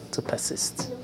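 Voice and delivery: breathy whispered answer; hushed answer to the question. Real, not acted. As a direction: whisper the key word.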